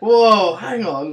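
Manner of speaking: loud, high startled "whoa"; mock astonishment. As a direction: pull up short, comically taken aback. Retelling his startled awakening.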